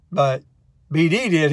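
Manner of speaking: normal talking voice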